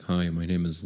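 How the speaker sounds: low monotone introduction. Shown as a demonstration. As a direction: drop the pitch and drain out the feeling. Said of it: "when I made my voice deeper, I removed all the emotion".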